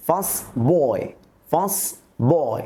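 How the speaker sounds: put-on First said as fas, running into boy; first boy without t. Shown as a demonstration. Teacher demonstrating elision for learners, t left out. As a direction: drop the t so First boy sounds like fasboy. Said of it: "আমরা টি এবং ডির উচ্চারণ করব না".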